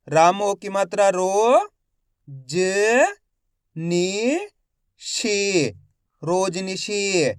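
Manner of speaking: slow spelling drill, each syllable held with a rising end; stretched syllables for beginning readers; letter-by-letter sounding out. Shown as a demonstration. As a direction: sound out each letter, then blend the word. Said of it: "एक एक अक्षर का उच्चारण".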